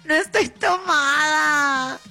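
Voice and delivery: emphatic mock-offended no; slow indignant denial. Real, not acted. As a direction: drag out the denial, half laughing. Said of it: "cómo te dejaron ir tomada".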